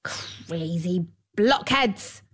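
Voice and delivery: slow, hissed storybook insult; snapped insult. A narrator's reading. Dwarf rebukes the girls, snapping in a hiss. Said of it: "snapped the dwarf".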